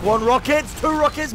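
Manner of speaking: rising pitch count of the rockets; excited play-by-play. Real, not acted. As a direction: build the hype rocket by rocket. Caster calling rising action.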